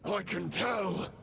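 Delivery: menacing villain. Acted. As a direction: taunt him like a dark knight. Villain speech in a boss fight.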